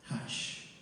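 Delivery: put-on soft, voiceless hush to a child; hushed words of comfort; priest's hushing. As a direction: gently hush a child at a funeral. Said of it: "words of the priest".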